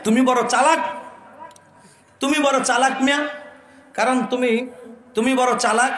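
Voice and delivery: high mocking scold; scolding imam. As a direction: snap at him like a fed-up imam. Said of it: "হুজুরে ধমক মেরে কইতাছে".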